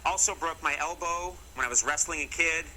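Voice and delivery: normal talking voice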